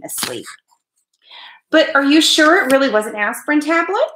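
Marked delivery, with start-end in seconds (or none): 1.7-4.1 high-pitched fretful questioning; mother's doubting, worried question. A narrator's reading. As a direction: fuss over it in a raised voice. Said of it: "could always find something to worry about".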